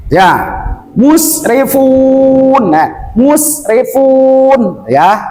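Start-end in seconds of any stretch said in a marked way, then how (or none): 1.0-2.7 musrifun slowly, with the fun held on a level note; long final vowel. Shown as a demonstration. Teacher models the recitation, musrifun with a long ending. 3.1-4.9 musrifun drawn out, falling off at the end; long final vowel. Shown as a demonstration. Teacher repeats it for students, musrifun with a long ending.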